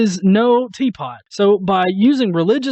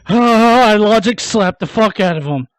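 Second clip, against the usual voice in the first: slow, louder, self-satisfied brag; smug mock boast; 3 semitones higher, 5 dB louder than usual. Parodying a smug atheist, a slow loud self-satisfied brag. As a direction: savor the victory slowly and loudly.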